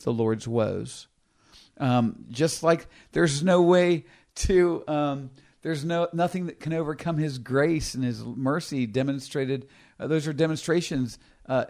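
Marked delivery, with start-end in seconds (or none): none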